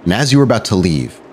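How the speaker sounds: ordinary talk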